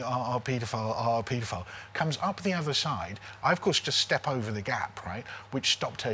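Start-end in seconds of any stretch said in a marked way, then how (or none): none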